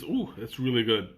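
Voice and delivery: ordinary talk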